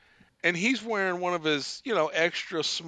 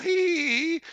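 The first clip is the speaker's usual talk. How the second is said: high "hee hee hee" imitated giggle; mock laugh; 10 semitones higher than usual. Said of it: "stare and laugh".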